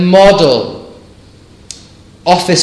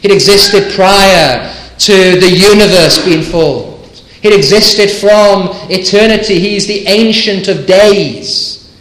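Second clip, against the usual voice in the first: raised, ringing sermon delivery, higher pitched; emphatic preaching; 6 semitones higher, 4 dB louder than usual. Real, not acted. Preacher proclaiming Christ's eternity to the congregation, emphatic preaching. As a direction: preach it phrase by phrase with building insistence.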